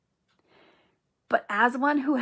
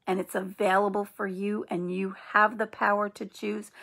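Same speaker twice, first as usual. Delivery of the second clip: ordinary talk, nothing marked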